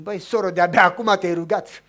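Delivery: loud, triumphant; loud preacher's exclamation; emphatic exclamation. Real, not acted. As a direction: drive the point home. Exclaims to the woman.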